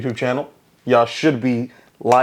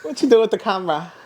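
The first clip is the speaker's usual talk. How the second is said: much higher, imitation Kiwi accent; put-on New Zealand accent; 9 semitones higher than usual. Imitating girl asking about the camera, mock New Zealand accent. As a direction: mimic a New Zealander, voice pitched high. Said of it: "mine almost shifted to Irish".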